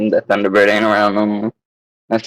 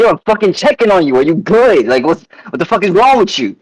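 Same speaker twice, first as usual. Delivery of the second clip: retold angry yelling; 8 semitones higher than usual. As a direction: replay yourself losing your temper on the sidewalk. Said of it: "And so I got mad".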